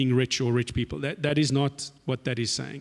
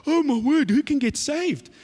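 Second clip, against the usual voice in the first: high, loud panicked cry; freaking out; 11 semitones higher, 4 dB louder than usual. Retold or imitated. Disciples panic over who can be saved, alarmed cry. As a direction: blurt it out in alarm. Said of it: "they freak out".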